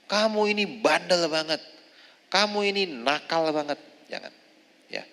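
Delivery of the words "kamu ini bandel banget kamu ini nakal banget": higher mock scold at the kid; a light hammed-up nagging mother; scolding child. Mother scolding her child as naughty, mock nagging. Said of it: "kata-kata yang tidak baik".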